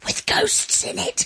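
spooky breathy whisper. Pretend. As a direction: whisper it like a ghost. Teasing ghostly aside.

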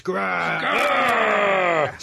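playful mock growl. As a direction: growl it in fun. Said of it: "Sorry, couldn't resist".

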